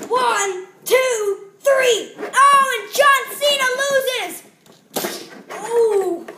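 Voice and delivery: high, loud play-by-play; excited commentator. Pretend. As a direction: call the finish like a ringside announcer.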